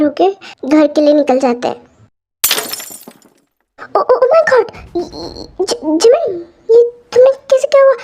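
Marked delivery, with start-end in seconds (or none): 4.0-4.7 high, startled oh my god; sudden panicked exclamation; shocked gasp. Acted. Mother sees Jimin hurt. 5.6-7.9 high, fast, near-crying alarm; frantic worried mom; panicked mother. Acted. Mother panics over injury.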